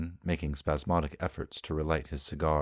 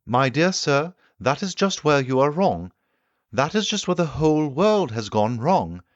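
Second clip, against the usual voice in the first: higher, louder, eager explaining voice; the keen inventor holding forth to his guests; the Time Traveller lecturing; 10 semitones higher, 9 dB louder than usual. Acted. Time traveller corrects the doctor.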